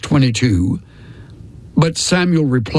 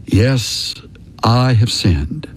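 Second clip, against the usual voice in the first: understated confession, lower in pitch; admission; 4 semitones lower, slower than usual. A narrator's reading. Saul admits his sin to Samuel, understated confession. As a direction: admit it with heavy pauses, pitched down. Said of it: "Then Saul admitted to Samuel".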